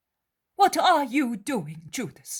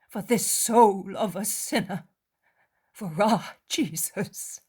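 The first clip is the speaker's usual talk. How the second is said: tearful mother; 7 semitones higher, 7 dB louder than usual. Acted. Mary weeping for Judas and urging prayer. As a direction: speak through sorrow, pleading.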